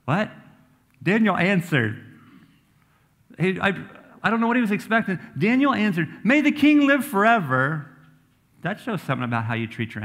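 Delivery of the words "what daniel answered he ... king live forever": high, loud and amazed. Real, not acted. Preacher marvels that Daniel blesses the king, high and amazed. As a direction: marvel at it, pitch up.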